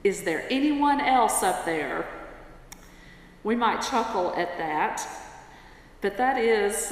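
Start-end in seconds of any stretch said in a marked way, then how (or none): 0.0-2.0 louder mock yell upward. Tourist, refusing to let go, calls for someone else, yelling. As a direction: call out past the calm voice, half yelled. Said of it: "the tourist yelled".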